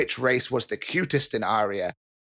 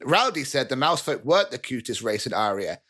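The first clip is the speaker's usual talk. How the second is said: usual speaking voice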